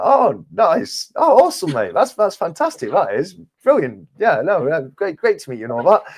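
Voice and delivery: high-pitched, over-keen politeness; gushing enthusiasm. Retold or imitated. Traveller humouring the Canadian stranger, high and gushing. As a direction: play up being thrilled, pitched well up.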